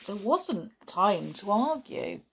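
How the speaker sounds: ordinary delivery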